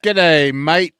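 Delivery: loud mock Australian accent, high and bright; hammed-up Aussie greeting. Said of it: "Now Australian".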